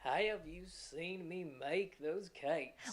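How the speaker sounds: slow Southern drawl, gliding vowels; Southern accent. Shown as a demonstration. Coach combining the two sounds in a sentence, Southern drawl. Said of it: "it'll sound something like this".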